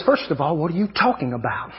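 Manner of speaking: low, soft, deadpan comeback; unimpressed retort. Retold or imitated. Preacher voicing his wife at the dishes, low unimpressed reply. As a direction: drop it low against the excitement. Wife's cool retort to excited husband.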